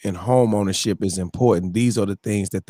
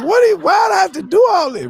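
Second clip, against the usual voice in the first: loud, high and fast complaint; exasperated loud whine about the paperwork; mad; 5 dB louder, faster than usual. Retold or imitated. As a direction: vent the frustration in a rush. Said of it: "I was mad".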